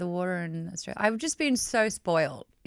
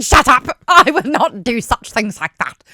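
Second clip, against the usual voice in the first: Draco Malfoy; 2 semitones higher, 11 dB louder than usual. Acted. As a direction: yell it as an overblown, bad villain impression. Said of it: "that impersonation".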